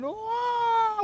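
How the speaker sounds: high, drawn-out imitation of a scream; mock scream. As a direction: imitate an excited scream without full volume. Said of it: "My daughter was screaming".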